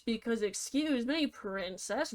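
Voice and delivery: ordinary talk, nothing marked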